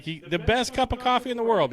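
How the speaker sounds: high and fast, bursting with delight; excited elf. Retold or imitated. Retelling Buddy praising the coffee, high and quick. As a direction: blurt it out like a starstruck kid. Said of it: "he's like".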